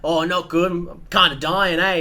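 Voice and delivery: lightly voiced old man, slow and complaining. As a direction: groan it like an old pub regular. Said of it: "he's just".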